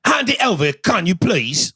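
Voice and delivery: high, exaggerated British accent; mock polite English robber. Guest acts robbers who say please, high mock English accent. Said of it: "they are very polite about how".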